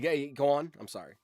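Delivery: ordinary talk, nothing marked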